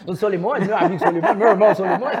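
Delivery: loud laughing while talking. Real, not acted. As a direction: laugh through the names. Joking about the [name] duo.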